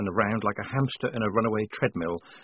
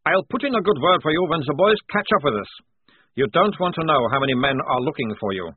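put-on confident, higher accented prisoner voice; captured sergeant; 6 semitones higher, 5 dB louder than usual. A narrator's reading. Bound sergeant warning his captors, cocky and accented. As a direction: play a cocky captive with v for w.

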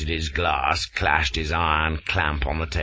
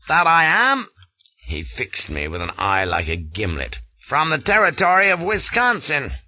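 stern headmaster scolding, higher and louder; scowling American colonel; 7 semitones higher, 3 dB louder than usual. A narrator's reading. Colonel scowling down at the shivering Flashman, angry. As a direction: bark it down at him like an angry headmaster. Gardner's voice.

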